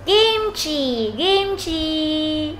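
high, held, sing-out "kimchi"; photo-time kimchi. Shown as a demonstration. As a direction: stretch both syllables for the camera. Photo pose word, held out.

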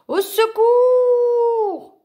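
long, high, loud drawn-out scream; screaming for help. Acted. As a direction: wail for help as the rock flies. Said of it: "hurla Loup".

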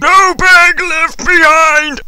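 high, slow, drawn-out shout; hammed-up rallying cry, every word stretched; heroic battle cry. Driver rescuing a school bag, mock-heroic yell.

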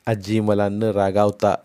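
plain reading voice

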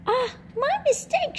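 tiny chick voice, cheerfully wrong; peeping smallest chick. A narrator's reading. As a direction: peep it like a silly little chick. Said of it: "she peeped".

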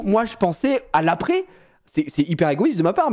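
ordinary delivery

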